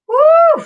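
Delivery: loud high woo cheer. Real, not acted. Cheering during the dance.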